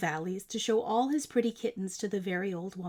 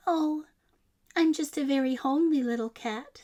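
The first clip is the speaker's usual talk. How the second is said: kitten; 5 semitones higher than usual. A narrator's reading. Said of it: "said the kitten".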